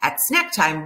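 ordinary delivery

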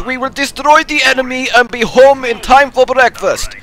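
hammed-up Russian accent, high and loud; bad Russian commander rallying troops. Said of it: "My Russian accent is an insult to all Russians".

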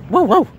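high, loud woof woof; playful dog bark. Pretend. Calls friend mi perrito, barks.

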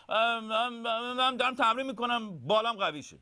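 high, stammering owlet voice; high-pitched, caught-out little brother; hesitant young owl. Acted. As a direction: stall with repeated ums, then explain.

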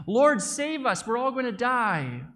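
high, loud, slow desperate cry for help; terrified yell. Acted. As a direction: scream it as if the boat is sinking. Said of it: "Peter yelled face to face".

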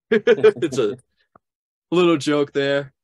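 high, loud, laughing words; chuckling at your own joke. Real, not acted.

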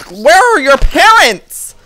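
high, loud, disbelieving outcry; shrill exasperated yelling. Real, not acted. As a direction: shout in mock outrage at the swarm. Swarmed by zombie children at night.

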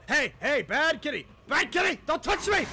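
high, nervous telling-off of a creature; scared scolding. Real, not acted. Player scolding a monster cat, high nervous scolding. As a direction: shoo it away in a jittery voice.

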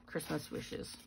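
whispered to herself; soft whisper reading the card; whispered aside. Real, not acted. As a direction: breathe the words under your breath. Murmurs sentiment under her breath.

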